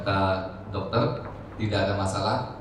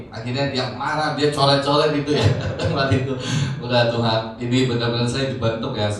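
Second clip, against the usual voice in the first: normal talking voice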